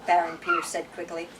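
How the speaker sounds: steady read-aloud narrator voice; story narration. A narrator's reading. Reading a novel aloud to the group, story narration. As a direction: tell the tale to your listeners.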